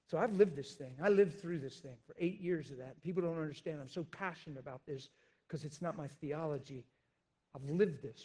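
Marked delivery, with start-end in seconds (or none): none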